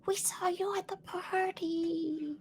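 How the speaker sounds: high, thin whispery squeak; tiny faraway rat, very high; little distant rat voice. Acted. Game master voices rats hailing the party, little distant voice. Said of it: "the little voice off in the distance".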